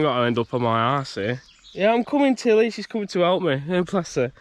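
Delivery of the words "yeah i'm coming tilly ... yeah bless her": higher fond pet talk; dog-directed voice. Real, not acted. Talking sweetly to Tilly as she comes over, higher. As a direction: coo at her like a pet.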